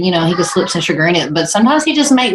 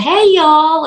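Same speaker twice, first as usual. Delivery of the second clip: high, hollered Southern hello; exuberant greeting; 8 semitones higher, 3 dB louder than usual. Retold or imitated. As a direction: call it out to the whole group. Retelling her greeting on Zoom.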